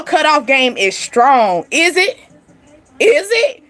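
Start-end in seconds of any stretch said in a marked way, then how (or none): 1.7-3.6 high, rising teasing question; playful feigned doubt; mock questioning. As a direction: ask it with pitch climbing well up.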